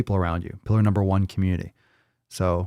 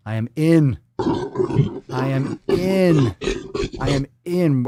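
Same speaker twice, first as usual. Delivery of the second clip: higher, stretched-out gleeful declaration; hammed-up eagerness; 5 semitones higher, much slower than usual. Host relishes a listener's coming rant, hammed-up eagerness. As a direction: build it big and drawn out.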